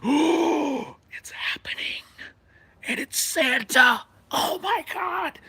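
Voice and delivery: high, breathless half-whisper building to a squeal; excited squeal of disbelief; thrilled gasp. Retold or imitated. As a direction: gasp like a kid watching a fight start. Kid thrilled as Santa erupts.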